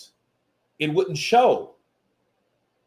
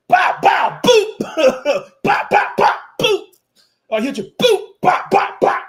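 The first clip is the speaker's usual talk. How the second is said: shouted, high bow bow boop punch noises; punch sound effects; 7 dB louder than usual. Retold or imitated. Mimicking a fighter's dirty punches, shouted high. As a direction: bang out punches with your mouth.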